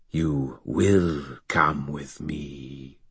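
low, slow, soft alien monster; buzzing monster voice. Acted. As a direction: menace it out like a buzzing creature. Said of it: "buzzing, twisting voice".

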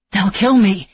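loud, rushed fear; panicked rush. Acted. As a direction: blurt it out in panic. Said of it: "he told her in a rush".

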